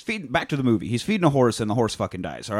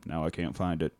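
deadpan one-liner; 5 semitones lower, 5 dB quieter than usual. Retold or imitated. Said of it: "in deadpan delivery".

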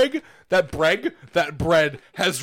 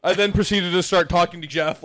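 ordinary delivery

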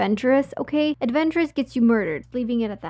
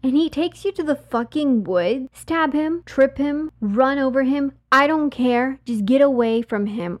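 raised, louder, punchy urging; heated warning; 4 semitones higher, 5 dB louder than usual. Real, not acted. Host warning women about men in woods, heated urging. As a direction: drive each command home, high and forceful. Host's heated safety advice to listeners.

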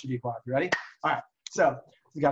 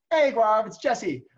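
calling out; 7 semitones higher, 8 dB louder, much slower than usual. Pretend. Role-play knocking at the door.

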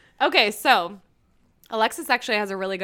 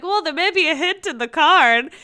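higher, louder, heavy-handed hint; mock obvious hinting; 7 semitones higher, 8 dB louder than usual. Host imitating a man hinting about his gift, mock coy and obvious. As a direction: drop a hint far too obviously. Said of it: "he's being really obvious".

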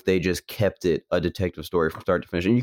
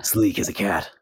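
noir detective; 2 semitones higher than usual. Retold or imitated. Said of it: "he meets Catwoman and he was like".

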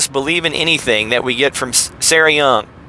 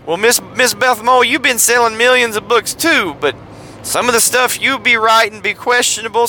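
high-pitched, bad Southern chauffeur impression; hammed-up Southern driver voice; Hoke from Driving Miss Daisy; 5 semitones higher than usual. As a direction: play a deferential old-movie driver, badly, pitched up. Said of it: "a really bad impression of me doing Morgan Freeman's Hoke character".

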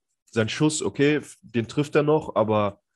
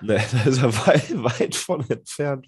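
chuckling through the words; talking while laughing. Real, not acted. Laughing at Draymond joke.

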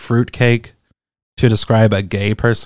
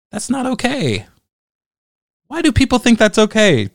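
exasperated disbelief; 10 semitones higher than usual. Real, not acted. Ranting about a hateful comment.

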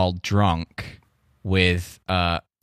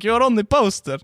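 high, eager mock Russian accent; hammed-up Russian accent; 11 semitones higher than usual. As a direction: insist cheerfully as the Russian host in a Russian accent. Russian host quoted.

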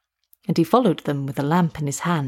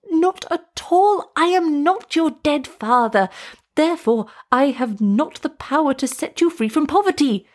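frightened insisting; 7 semitones higher than usual. A narrator's reading. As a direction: insist though terrified. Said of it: "The frightened witch doctor ... insisted".

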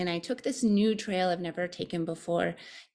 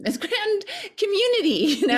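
laughing; 14 semitones higher, 8 dB louder than usual. Real, not acted. Laughing at grand scheme.

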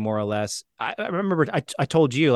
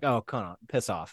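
brotherly annoyance; 6 dB quieter than usual. Retold or imitated. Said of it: "the way that I've always been mad at my brother".

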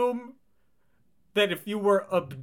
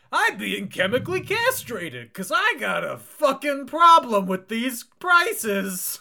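high, slow ad-man voice; hard sell ad; 9 semitones higher than usual. Acted. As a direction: drag it out like a cheesy commercial. Mimicking crazy-prices ad.

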